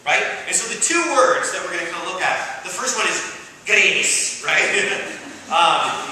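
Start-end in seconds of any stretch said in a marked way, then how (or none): none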